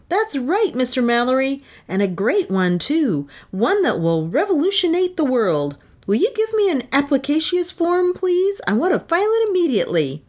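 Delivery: high-pitched, swooping inventor voice; eager self-important inventor. Acted. Inventor demands a patent form from official, eager. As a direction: press your claim eagerly in a high voice.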